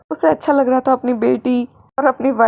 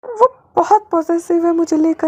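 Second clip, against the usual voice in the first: tearful; 6 semitones higher than usual. Real, not acted. Speaker crying over situation.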